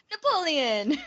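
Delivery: laughing, hammed-up Italian accent; laughing mock Italian accent. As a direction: toss off Napoleon in a silly Italian accent. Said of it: "why I'm saying it like an Italian".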